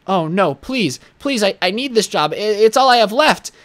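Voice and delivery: high, hammed-up begging; mock pleading. Bagger fake-begging the manager for his job, hammed-up high plea. Faking despair at staged firing.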